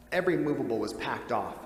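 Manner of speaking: plain reading voice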